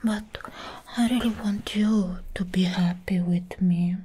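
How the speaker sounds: normal talking voice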